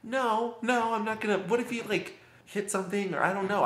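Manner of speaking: very high, rushed and anxious; panicked. Retold or imitated. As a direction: voice shoots up in worried panic. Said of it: "And I freaked out".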